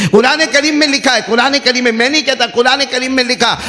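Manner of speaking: emphatic preaching. Acted. Preacher insisting to congregation that the Quran says it, emphatic. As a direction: drive the point home from the pulpit. Preacher raises voice to cite scripture.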